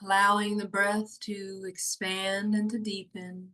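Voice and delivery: hushed guiding voice for a body-awareness meditation; guided meditation. Acted. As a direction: ease them down into calm, slowly.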